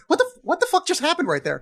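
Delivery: high-pitched, quick burst of bewilderment; baffled disbelief. Acted. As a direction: blurt it out as a stunned audience member. Imagined baffled audience reaction.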